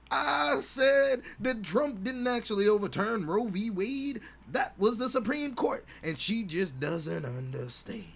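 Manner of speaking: bitter divorced dad. Acted. Voicing a bitter MAGA dad.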